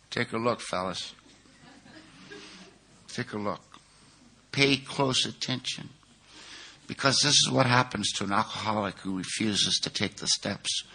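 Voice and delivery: a hint of a calm, grave old sponsor; quiet sponsor voice. Sponsor Charlie shows newcomers the sick speaker.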